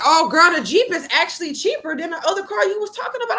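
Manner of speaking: put-on high, fast, bubbly girlfriend voice; excited saleslady. Saleslady tells her the Jeep is cheaper, high fast excitement. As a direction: rush it out like happy gossip. Saleslady shares good news.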